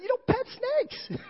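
very high, drawn-out wail; mock horror. Father to son touching the snake, high mock-horrified wail. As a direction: wail it slowly in exaggerated dismay.

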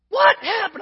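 loud, high mock surprise; feigned shock. Son pretending not to know about the fire, loud fake surprise. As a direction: cry out as if innocent. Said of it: "And I was like".

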